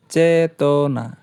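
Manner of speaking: very slow, drawn-out চেতনা; চেতনা stretched slowly syllable by syllable. Shown as a demonstration. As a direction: draw চেতনা out to count its beats. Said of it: "একসাথে উচ্চারণ হয়েছে".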